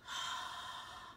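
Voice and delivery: open-mouthed hah exhale, fogging glasses; ocean throat breathing. Shown as a demonstration. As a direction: breathe out to fog a lens. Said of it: "Inhale again".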